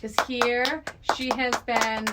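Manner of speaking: normal talking voice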